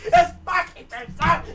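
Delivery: loud, high exasperated yell. Acted. Player ranting about the game's likeness, exasperated yell. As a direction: explode into a comic rant.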